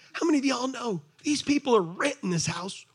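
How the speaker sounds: ordinary delivery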